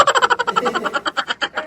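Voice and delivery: very high, loud peal of laughter; real cackling laugh. Real, not acted. Host laughs at joke about debt records, high cackle.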